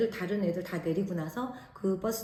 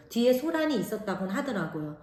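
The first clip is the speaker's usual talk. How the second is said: ordinary delivery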